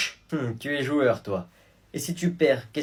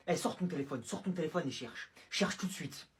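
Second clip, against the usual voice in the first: much softer threatening order; quiet menace; 12 dB quieter than usual. Acted. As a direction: drop the voice to a cold command. Menacing order after outburst.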